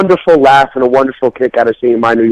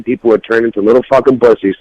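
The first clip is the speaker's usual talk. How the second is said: ordinary delivery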